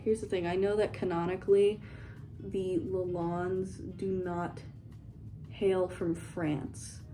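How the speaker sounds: usual speaking voice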